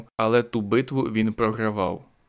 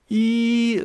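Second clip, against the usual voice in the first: much higher, louder yell; war cry; 12 semitones higher, 4 dB louder than usual. Acted. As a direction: charge with a scream. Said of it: "загорлав Зарізяка".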